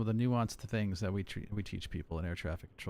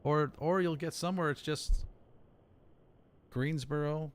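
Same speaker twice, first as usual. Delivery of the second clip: usual speaking voice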